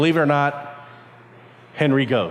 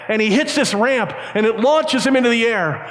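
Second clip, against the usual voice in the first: usual speaking voice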